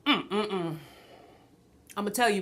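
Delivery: ordinary delivery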